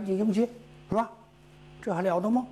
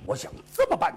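ordinary delivery

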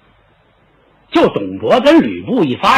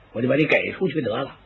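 plain reading voice

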